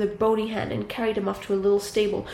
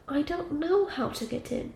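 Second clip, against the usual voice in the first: put-on higher, softly puzzled girl's voice; lilting, naive questioning; innocent little Gretel; 6 semitones higher, 3 dB quieter than usual. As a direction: play helpless in a light, high voice. Gretel feigns helplessness.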